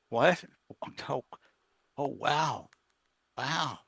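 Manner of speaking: imitating someone caught off guard, half gasping; stunned surprise. Ex learns the money came from him, softly stunned. Ex hears church money was his.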